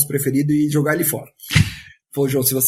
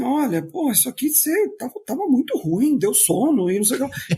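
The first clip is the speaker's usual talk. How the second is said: mock blunt criticism, pitched high; high-pitched, lightly acted bluntness; intense blunt feedback; 7 semitones higher than usual. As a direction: imitate frank, intense feedback in a higher voice. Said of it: "falam de um jeito intenso".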